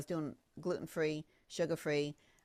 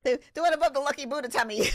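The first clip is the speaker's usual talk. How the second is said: ordinary delivery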